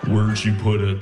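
low eerie creep voice; Buffalo Bill voice. Retold or imitated. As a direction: mimic a menacing killer's murmur. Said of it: "Why do you sound like Buffalo Bill?".

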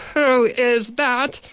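a displeased pot scrubber demanding to know; grumpy demand. A narrator's reading. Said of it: "the man demanded none too happily".

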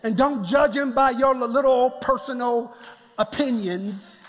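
usual speaking voice

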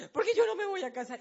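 high, loud, fast mock wail; imitating crying daughter. Daughter sobbing her answer to her father, high and fast. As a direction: whine it out like a sobbing child. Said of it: "se atacaba a llorar".

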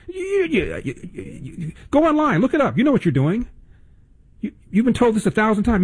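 mock sputtering, very high-pitched; flustered heckler. Host mimics the heckler from the clip, mock flustered. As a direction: stammer into a squeaky accusation.

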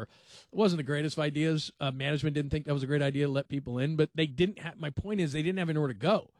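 normal talking voice

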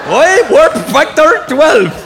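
put-on high, loud, slow Scottish accent; Scotty's Scottish accent. Engineer calling out warp speed, drawn-out Scottish shout. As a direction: bellow it as a hammed-up Scottish engineer. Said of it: "Scotty in Star Trek was the only one".

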